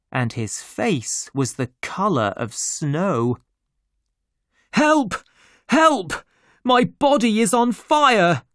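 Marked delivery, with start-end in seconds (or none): 4.7-8.4 high, loud cries for help; panicked shout. A narrator's reading. Doctor on the beach screaming for help, panicked shout. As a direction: yell it as if burning alive. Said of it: "the doctor shouted".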